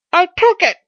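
very high, loud confession; rueful Pycraft. Acted. Pycraft confessing to his visitor, high and loud.

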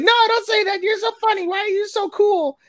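put-on very high flustered girly voice; flustered squeal. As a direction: squeal it out, embarrassed and giddy.

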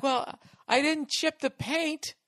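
high, slow snooty lady voice; a prissy woman brushing off the damage, mocked high and slow; mock dismissive lady.

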